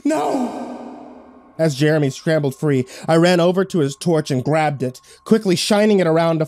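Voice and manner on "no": high eerie shriek; shrill witch scream. Acted. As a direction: screech it like a hag. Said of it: "eliciting a shrill scream from her lips".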